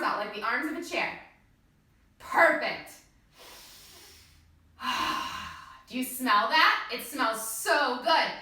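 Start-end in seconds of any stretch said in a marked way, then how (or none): 4.8-5.6 long breathy exhale of pleasure; demo sigh. Shown as a demonstration. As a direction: breathe out audibly as if smelling sauce. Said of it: "Take another big deep breath in".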